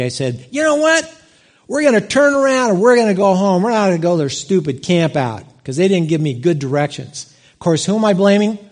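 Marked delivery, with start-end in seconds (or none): 0.5-7.3 high, loud start that eases off; mock-indignant declaration of quitting; retold angry outburst. Dad fed up with a lost drive to the campout, retold angry outburst. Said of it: "I said something really angry".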